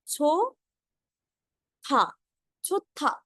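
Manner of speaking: छो then था, then छुत्था with a doubled tt; syllable by syllable. Shown as a demonstration. Teacher sounds out छुत्था in parts for students. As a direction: split it, then join it as छुत्था. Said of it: "second वाला word छुत्था".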